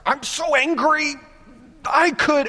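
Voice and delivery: high-pitched mock fury; cartoonish angry squeak; mock angry rant. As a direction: sputter like someone too mad to finish.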